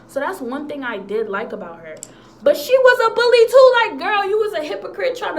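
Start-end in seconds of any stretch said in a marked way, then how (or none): 2.4-5.4 outraged exclamation. Real, not acted. Exclaiming about bully teacher.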